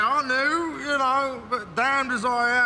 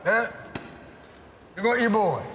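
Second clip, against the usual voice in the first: ordinary talk, nothing marked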